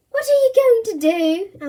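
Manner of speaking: put-on high, anxious boy voice; worried question; nervous. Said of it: "asked Eugene nervously".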